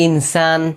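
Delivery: ইনসান with the n sounded fully and clearly, slow; ইনসান with a clear n, no nasal blur; clear noon. Shown as a demonstration. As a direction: say ইনসান slowly, every n plain. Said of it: "এটা স্পষ্ট উচ্চারণ হবে".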